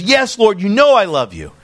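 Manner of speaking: high, insistent protest of love; emphatic. Retold or imitated. Peter answering Jesus about his love, emphatic. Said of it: "He's emphatically saying this".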